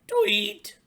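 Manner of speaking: high, loud chirping tweets; birdy tweets. Acted. Little pig up in the tree chirping like a bird, high and loud. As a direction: chirp away like a songbird.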